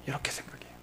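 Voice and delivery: barely voiced whisper; hushed little aside. Real, not acted. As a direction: breathe it out as a secret.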